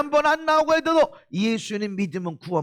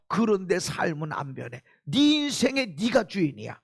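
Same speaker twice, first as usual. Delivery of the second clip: ordinary talk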